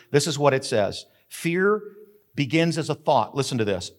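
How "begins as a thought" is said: ordinary talk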